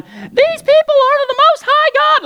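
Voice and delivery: high, loud, slow mock proclamation; hammed-up little girl shouting; mock announcing girl. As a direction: belt it like an over-the-top herald girl. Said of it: "this little girl's going".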